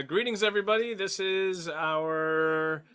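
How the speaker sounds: slow, showy host intro; drawn-out announcer opening; a mock-grand welcome to the show; podcast intro. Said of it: "you take the intro this time".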